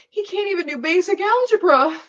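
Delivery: high squeaky kitten voice; tiny kitten voice. Retold or imitated. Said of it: "this tiny little kitten".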